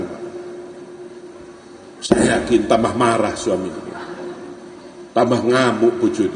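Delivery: ordinary delivery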